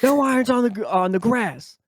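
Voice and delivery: put-on high, loud scolding old man; mock cranky old-timer yelling from his cart. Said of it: "This old man goes".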